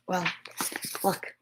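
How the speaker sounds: plain reading voice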